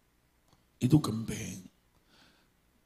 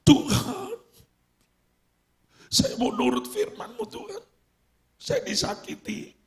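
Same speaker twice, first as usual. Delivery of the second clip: high, strained surrender prayer; tearful prayer; 4 dB louder than usual. Acted. Hurt believer praying to God to forgive, tearful. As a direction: pray it like someone giving in through tears.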